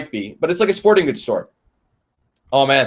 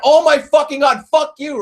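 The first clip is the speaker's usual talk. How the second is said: high, loud furious outburst, retold; pitched-up, exploding in outrage; losing it; 11 semitones higher, 5 dB louder than usual. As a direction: re-enact blowing up at him. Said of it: "I'm losing my mind".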